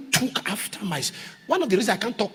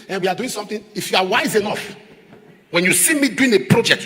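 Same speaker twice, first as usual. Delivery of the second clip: normal talking voice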